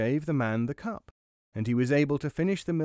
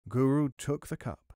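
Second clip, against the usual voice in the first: ordinary delivery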